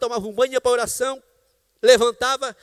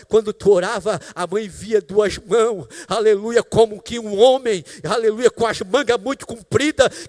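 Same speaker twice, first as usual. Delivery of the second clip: preaching retelling; 2 semitones higher, 6 dB louder, slower than usual. Acted. Mother's story preached with fervour.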